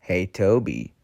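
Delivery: a hint of smarmy scheming engine; Diesel. Engine luring Toby to the trucks, lightly oily. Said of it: "in his oily voice".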